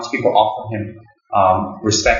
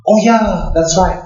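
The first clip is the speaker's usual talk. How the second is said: Dalai Lama's reply; 9 semitones higher, 4 dB louder, slower than usual. Retold or imitated. Dalai Lama voiced, retold.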